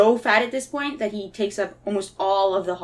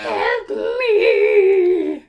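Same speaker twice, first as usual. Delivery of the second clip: high, loud desperate plea; desperate cry for help; 9 semitones higher, 6 dB louder than usual. Acted. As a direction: wail it like a trapped soul. Trapped faces cry out.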